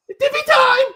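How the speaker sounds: very high, loud "Divi time!" like a squealing wrestler; mock catchphrase shout. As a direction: scream the catchphrase in a hammed-up voice.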